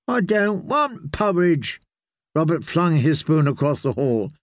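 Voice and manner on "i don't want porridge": put-on high, slow sulky child voice; a spoiled boy refusing his food. A narrator's reading.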